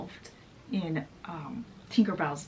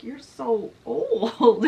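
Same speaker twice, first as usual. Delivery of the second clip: teasing Peter; 2 semitones higher, 5 dB louder, much slower than usual. Acted. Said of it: "He's like".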